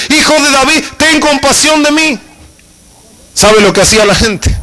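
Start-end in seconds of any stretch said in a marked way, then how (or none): 0.1-2.2 high pleading cry for mercy. Retold or imitated. Preacher voicing the blind beggar crying out to Jesus, high pleading call. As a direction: lift the voice and beg to be heard. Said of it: "se puso a gritar".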